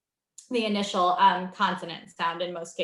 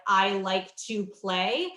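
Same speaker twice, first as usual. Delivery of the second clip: slow word by word; much slower than usual. Shown as a demonstration. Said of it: "helping them hear".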